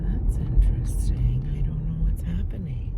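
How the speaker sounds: hushed, puzzled whisper; whispered, unsure what's ahead. Real, not acted. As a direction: whisper to yourself while driving. Whispering about car ahead.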